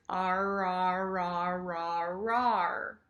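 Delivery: "ar" chained on one low note; roaring "Ar". Shown as a demonstration. Teacher shows tongue going backwards, low chained "Ar". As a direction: curl the tongue back through continuous "ar". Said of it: "my tongue goes backwards".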